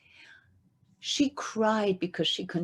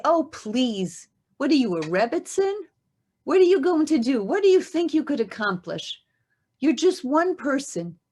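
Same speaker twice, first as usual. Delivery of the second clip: scoffing; 4 semitones higher, 5 dB louder than usual. Acted. Mocking doubter voiced.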